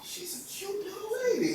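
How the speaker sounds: high, soft retold marvelling; awed little voice. Awed first sight of her idol.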